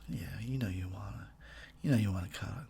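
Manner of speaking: breathy mock-seductive whisper; suave whisper. To his female listeners, a cheesy seductive whisper. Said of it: "That's my suave voice".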